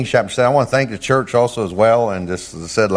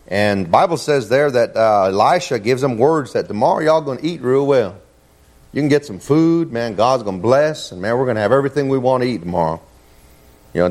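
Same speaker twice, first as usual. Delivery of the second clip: ordinary delivery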